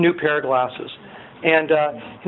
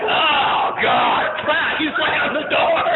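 woken grumpy friend; 9 semitones higher, much slower than usual. Retold or imitated. Groggy friend woken early.

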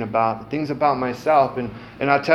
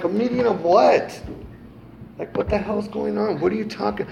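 mocking disbelief; 7 semitones higher than usual. Mocking wife's meeting invitation.

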